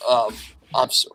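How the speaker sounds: usual speaking voice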